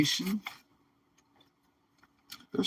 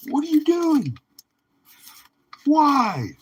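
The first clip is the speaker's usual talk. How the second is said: high, squeaky mock disbelief; exasperated; 4 dB louder than usual. Streamer imitating shaking a collector, exasperated squeal. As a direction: throw up your hands in mock outrage. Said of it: "wanna grab 'em and shake 'em and be like".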